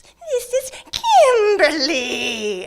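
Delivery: very high, slow creepy sing-song; high, slow creepy stalker voice; eerie stalker voice. Retold or imitated. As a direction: stretch Kimberly out, high and haunting. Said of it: "she goes".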